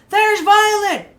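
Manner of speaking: high, loud, drawn-out cheer; jubilant shout. Acted. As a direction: call out to the crowd in triumph. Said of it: "the boy shouted jubilantly".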